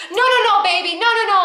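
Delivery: high, frantic backpedaling; pleading, convincing. Retold or imitated. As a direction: imitate a boss begging someone to stay. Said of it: "trying to convince me not to quit".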